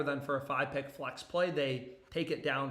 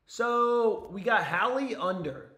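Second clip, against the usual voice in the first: higher, louder, slowed-down hype announcement; hyped reveal; 6 semitones higher, 6 dB louder, slower than usual. Real, not acted. Streamer hyping his pick to chat, drawn out and excited. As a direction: sell the pick like a big reveal.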